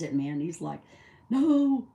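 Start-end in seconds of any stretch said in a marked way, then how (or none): 1.3-1.9 high, loud disbelieving yelp; shocked no. Retold or imitated. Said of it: "He, like, freaked out".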